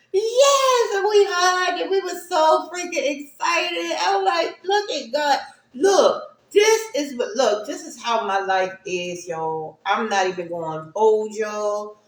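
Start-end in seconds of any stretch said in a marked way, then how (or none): 0.1-6.8 high and loud, crying with joy; bubbling over with happy tears; teary excitement; excited tears. Real, not acted. Joyful retelling, still crying.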